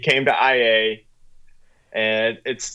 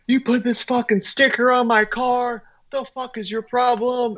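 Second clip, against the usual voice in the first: mock angry yell, pitched higher; retold yelling; 9 semitones higher than usual. Car owner berating Kyle at the booth, mock angry. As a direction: imitate an enraged car owner, lightly. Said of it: "he is yelling at Kyle".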